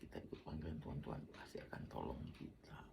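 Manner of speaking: soft, hushed persuading; soft whisper coaxing the stressed wife. Retold or imitated. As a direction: gently talk his wife round. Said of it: "Saya bujuk dia".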